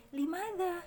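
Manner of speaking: "Limada" high, with thin vowels; smiling intonation. Shown as a demonstration. Teacher models a question, smiling intonation. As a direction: ask "Limada" high through a smile. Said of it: "banyak yang senyum di situ".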